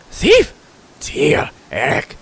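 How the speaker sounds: high, loud kobold echo; eager parroting creature squawk; enthusiastic crier. Acted. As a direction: shriek the first word, stretch the rest. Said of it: "echoes the crier enthusiastically".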